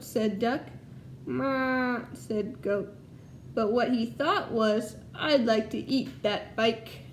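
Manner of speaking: lively storybook read-aloud to a class; reading to children. Acted. Teacher reading Duck on a Bike to her class, storytelling voice. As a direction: tell it brightly so the kids follow along.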